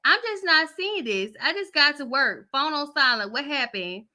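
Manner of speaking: lightly voiced girlfriend, casual and slangy; chatty sister checking in by text; sister's text voice. Vlogger voices little sister answering from work, lightly put on.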